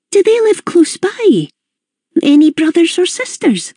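high, kindly Glaswegian mum voice; high, curious mother's voice; Mrs Gibbons. A narrator's reading. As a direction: voice the chatty older Scottish hostess, pitched high.

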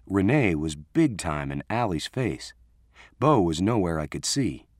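usual speaking voice

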